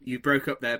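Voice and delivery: ordinary delivery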